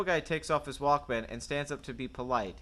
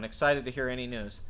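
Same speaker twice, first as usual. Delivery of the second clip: normal talking voice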